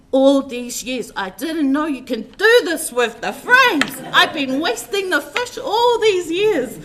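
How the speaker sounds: retold excited outburst. Retelling father-in-law's delight over the fried fish frames, loud and high. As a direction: burst out like a man who just found a treasure. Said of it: "And he said".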